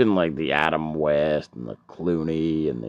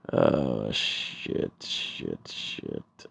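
whispered, sinking lower each time; dread whisper; 9 semitones lower, 6 dB quieter, much slower than usual. Acted. Drunk spotting the police, whispering low in dread. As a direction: hiss it under your breath as panic sets in.

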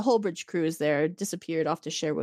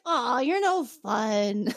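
slow, drawn-out mock disappointment; mock pouting; 3 semitones higher, much slower than usual. Podcaster retelling her teasing an officer, mock pouting. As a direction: draw it out like a playful sulk.

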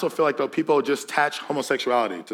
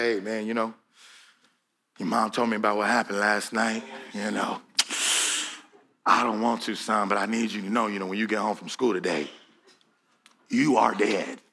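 stern father; 5 semitones lower, 4 dB quieter than usual. Retold or imitated. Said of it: "he'd come home and be like".

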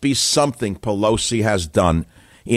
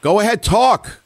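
high, slow, raised voice; to someone hard of hearing; 12 semitones higher, 4 dB louder than usual. Real, not acted. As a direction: call out slowly to a faint caller. Caller too quiet to hear.

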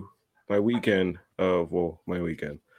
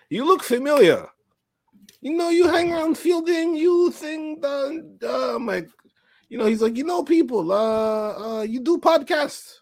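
put-on much higher Russian-accented rambling. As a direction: eagerly place him, Russian accent.

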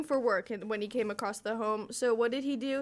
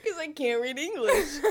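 laughing; 4 semitones higher, 3 dB louder than usual. Real, not acted. Laughing at own reading trouble.

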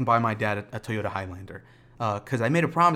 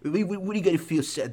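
higher-pitched mock Italian-accented dad; exaggerated Italian-dad impression, pitched up; father with Italian accent; 5 semitones higher than usual. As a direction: scold like a baffled father in a put-on Italian accent. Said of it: "That's my father. I don't know why I do an Italian accent".

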